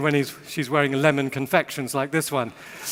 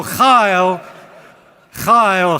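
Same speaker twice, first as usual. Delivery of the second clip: Chayil, chayil, proclaimed louder; emphatic repetition; 8 dB louder than usual. Real, not acted. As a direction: hammer the word home twice, louder. Preacher building emphasis.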